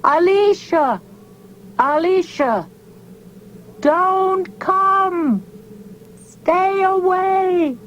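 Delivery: screamed warning. A narrator's reading. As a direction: scream out the window to warn her away. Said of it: "screamed out toward the desert beyond".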